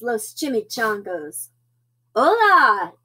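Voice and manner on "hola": loud called-out greeting, pitched up; hearty storybook call; holler. A narrator's reading. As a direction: holler back to your buddies. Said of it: "hollered back".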